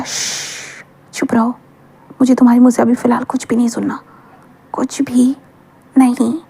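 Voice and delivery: shushing whisper; shushing his lover in a whisper; hushed whisper. Acted. Wang Ji hushing Wing.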